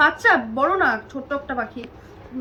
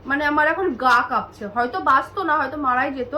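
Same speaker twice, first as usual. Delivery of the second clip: ordinary talk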